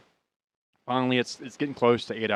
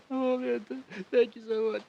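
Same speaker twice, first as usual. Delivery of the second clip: overwhelmed thanks; 12 semitones higher than usual. Retold or imitated. Relieved thanks after long ordeal.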